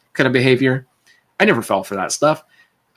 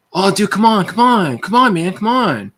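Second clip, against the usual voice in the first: higher-pitched, wheedling imitation of a bully; mock pleading; 9 semitones higher, 3 dB louder, slower than usual. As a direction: beg in a put-on, pestering voice. Bully begging for money, retold.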